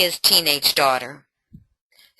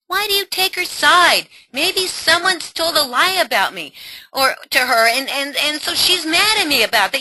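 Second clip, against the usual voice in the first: put-on higher, defensive teenage whine; defensive daughter; 7 semitones higher than usual. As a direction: daughter snapping at an interrogating mother. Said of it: "role play daughter".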